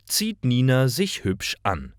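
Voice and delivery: usual speaking voice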